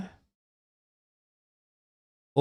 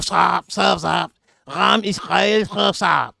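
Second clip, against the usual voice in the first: put-on higher, shouted surfer call in an Israeli accent; mock Israeli surfer accent; 4 semitones higher, slower than usual. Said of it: "That accent is crazy".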